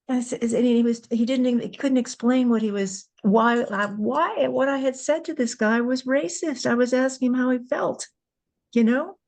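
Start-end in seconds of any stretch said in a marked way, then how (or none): none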